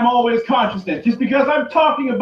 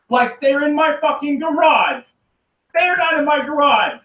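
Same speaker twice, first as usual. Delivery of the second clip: ordinary talk, nothing marked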